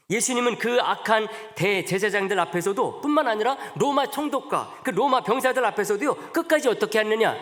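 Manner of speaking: preacher swelling as the music plays; building sermon. Acted. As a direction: climb toward the climax.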